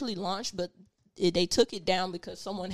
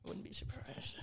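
whispering; 15 dB quieter than usual. Real, not acted. Quiet aside after fridge talk.

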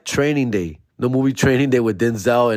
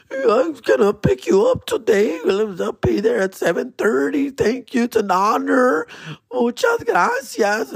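high, slow, overly polite; fawning; slower than usual. Retold or imitated. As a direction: grovel sweetly to your hero. Fawning to idol on phone.

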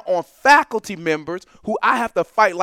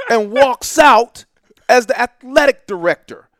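loud, high, drawn-out punchline; slow and emphatic; 5 semitones higher, 8 dB louder, slower than usual. Real, not acted. Professor telling listeners how the man got hired, slow and emphatic. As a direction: hammer it out slowly for the listeners. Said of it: "I wanna say this slowly and emphatically".

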